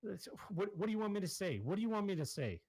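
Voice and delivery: threatened dealer begging the players; panicked shopkeeper. Acted. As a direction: squeak it out, desperate. Mitch cornered, threatened.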